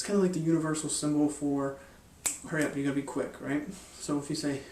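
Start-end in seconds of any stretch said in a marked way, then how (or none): none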